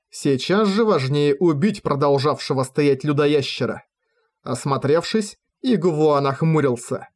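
ordinary delivery

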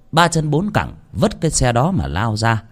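ordinary delivery, nothing marked

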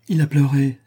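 wife exclaiming in a lifted voice. A narrator's reading. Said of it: "s'écria ma femme".

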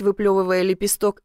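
ordinary delivery, nothing marked

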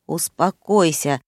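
ordinary delivery, nothing marked

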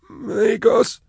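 a wrecked prisoner forcing out a forgotten word; broken stammer. Acted. Caged prisoner haltingly naming himself to strangers, high stammer. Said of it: "working very hard to articulate".

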